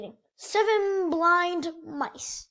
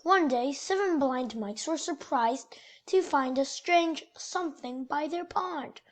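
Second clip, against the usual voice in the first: lively picture book storytelling voice; reading to children; 2 semitones lower than usual. A narrator's reading. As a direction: read it like bedtime story time for kids. Reads picture book aloud.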